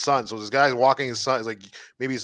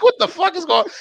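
much higher, strained what-the-fuck; squeaky disbelief; 4 dB louder, faster than usual. Retold or imitated. Retelling the robbery video, squeaking in shocked disbelief. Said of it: "I was like".

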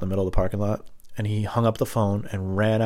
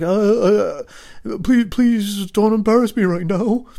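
high, louder, slow plea; pleading kid; 12 semitones higher, 6 dB louder, slower than usual. Retold or imitated. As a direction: beg mom not to embarrass you. Kid begging mom.

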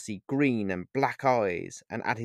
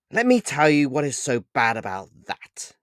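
sardonic retort, raised and emphatic; 3 semitones higher, 6 dB louder than usual. A narrator's reading. Pan answers Paul about what is so bad, sardonically. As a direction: answer with pointed, theatrical sardonicism. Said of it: "Pan replied sardonically".

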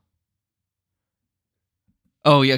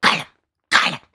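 Gollum throat noise; faster than usual. Acted. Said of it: "my best Gollum voice".

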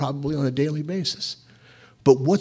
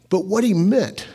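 ordinary talk, nothing marked

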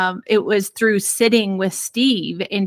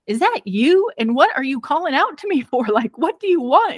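higher, snippy mock annoyance; mock irritated reply; 6 semitones higher than usual. Wife brushing off her husband at night, mock irritated and higher. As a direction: play yourself brushing him off, higher. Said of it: "And I'm like".

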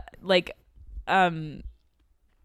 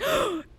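gasp; 4 dB quieter than usual. Retold or imitated. Retold star-struck gasp.

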